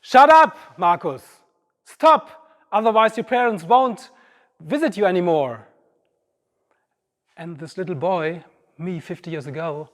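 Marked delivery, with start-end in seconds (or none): 0.0-5.7 loud, high, harsh telling-off; strict nurse shouting at a child; stern nurse scolding. Retold or imitated.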